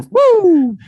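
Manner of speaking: high, loud "woo"; mock whoop. Comic voicing the clueless boss, mock whooping.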